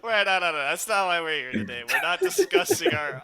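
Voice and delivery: loud laughing refusal; laughing protest. Real, not acted. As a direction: laugh and wave it off loudly.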